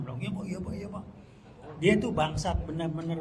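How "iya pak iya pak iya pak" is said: soft, higher, mock meek agreement; a hint of mock meekness; mock servile yes. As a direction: imitate a meek yes-man. Mimics meek servile reply.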